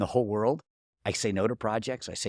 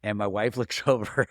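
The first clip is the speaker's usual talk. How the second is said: normal talking voice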